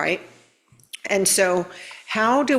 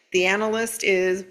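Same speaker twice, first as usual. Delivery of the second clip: ordinary delivery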